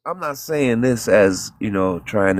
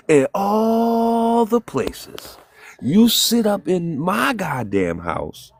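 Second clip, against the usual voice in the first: furious rant; 9 semitones higher, 3 dB louder than usual. Retold or imitated. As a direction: drag it out in disbelief, yelling at her. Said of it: "I said".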